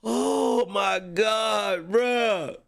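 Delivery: usual speaking voice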